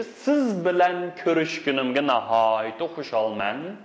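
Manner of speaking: ordinary talk, nothing marked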